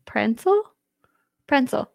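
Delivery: Pretzel as "prensel", asked, then stated; quirky pronunciation. Shown as a demonstration. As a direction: put an N in Pretzel: "PREN-sul". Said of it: "One more time".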